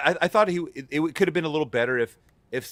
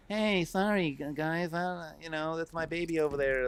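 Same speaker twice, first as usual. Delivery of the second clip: higher, softer mock apologetic voice; Deadpool character; 4 semitones higher, 6 dB quieter, slower than usual. Podcaster mocking a character's unfitting voice, higher and softer. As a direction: imitate a character too light and chatty for his looks. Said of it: "like he was a Deadpool character".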